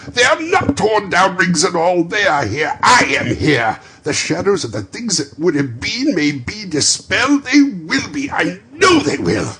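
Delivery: high, loud, overjoyed rush; bubbling over with relief; giddy joy. Acted. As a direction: ecstatic old man. Scrooge overjoyed on waking.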